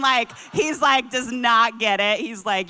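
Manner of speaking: usual speaking voice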